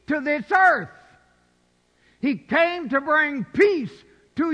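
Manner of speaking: loud, high preaching call; full-force preaching. Real, not acted. Preacher drives the point home to the church, loud and high. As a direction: ring it out over the congregation.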